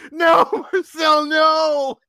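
high, loud mock scream; screaming store manager. Store manager pulling an employee off a customer, screaming. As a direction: cry out to stop him, voice pitched right up. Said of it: "it's the store manager screaming".

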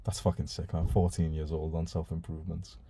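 ordinary delivery

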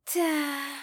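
high, drawn-out sighing word; sad sigh. A narrator's reading. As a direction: sigh it sadly as the little mouse. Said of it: "westchnęła smutnie Iksi". (smutne westchnienie myszki)

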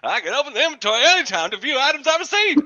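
high, quick character patter; put-on rookie voice. Rookie learning about the inventory, quick put-on voice.

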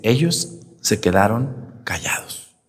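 slow breathy whisper; slow whispered gospel line. Acted. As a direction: hush it like a secret. Gospel line about silence.

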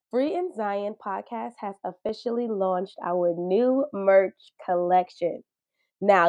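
upbeat promo voice; ad read. Acted. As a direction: pitch the merch to listeners. Podcast merch ad read.

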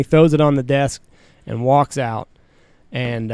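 usual speaking voice

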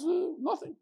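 high, soft dodge; evasive mock innocence. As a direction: play it small and high, brushing the question off. Hidden scholar dodges the question.

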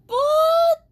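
very high, loud startled yelp; startled what. Real, not acted. Hearing a splish in the dark room, high startled yelp. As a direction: yelp it in shock.

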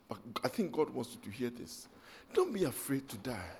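choked-up, quiet plea; emotional hush. Real, not acted. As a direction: pull the church in close, barely voiced. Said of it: "I'm so emotional about this".

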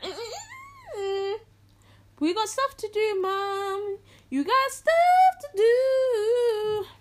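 high, drawn-out whine; mock whining refusal. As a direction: moan in protest, stretching the words. Acted whiny refusal to cinema request.

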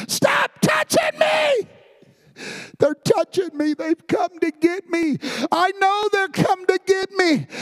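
dying man screaming in terror at unseen hands; terrified screaming. Retold or imitated. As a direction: scream like unseen hands are grabbing you. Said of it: "he's screaming".

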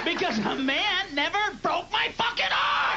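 high, building furious shout; angry rant. Real, not acted. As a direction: blast the hecklers back. Comic rant answering hecklers.

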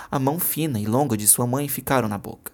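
ordinary delivery, nothing marked

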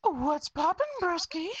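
very high, squeaky bro greeting. Pretend. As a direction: squeak out a cocky hello. Podcast member greeting in put-on voice.

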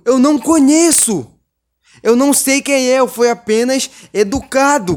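loud, high-pitched exasperated rant; indignant protest. Real, not acted. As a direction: protest your innocence loudly, voice pushed up. Ranting at the absent threatening father.

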